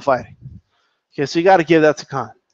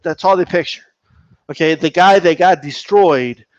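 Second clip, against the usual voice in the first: usual speaking voice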